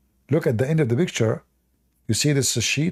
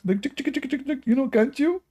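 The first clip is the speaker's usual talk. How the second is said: mock pleading squeak; 12 semitones higher than usual. Real, not acted. A streamer begging chat to comment, very high cutesy squeak.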